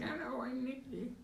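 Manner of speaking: high, soft put-on old voice; sample a high-pitched old character. Shown as a demonstration. Game master showing a player an old voice option, high old voice. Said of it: "do an old voice".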